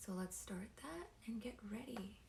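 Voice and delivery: quick unvoiced aside; whispering. Real, not acted. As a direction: whisper it off-mic. Aside while setting up recording.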